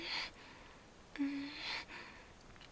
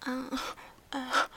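crying moan; 6 dB louder than usual. Acted. Climax moan.

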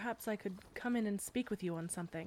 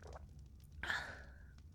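contented sigh; 10 dB quieter than usual. Acted. Sigh after sipping milk.

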